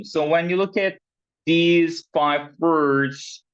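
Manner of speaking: usual speaking voice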